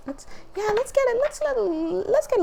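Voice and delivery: very high excited squeal. Real, not acted. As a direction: squeal as the card comes. Excited pulling cards.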